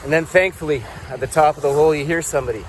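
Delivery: usual speaking voice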